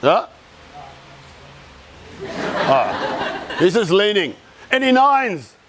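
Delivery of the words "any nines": high-pitched call to the room; auctioneer-like question, pitched up. Real, not acted. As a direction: call it out to the whole audience. Calling for a show of hands.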